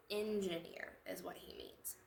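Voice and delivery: soft unvoiced aside; whispered correction. Milk quietly correcting the little brother, whispered.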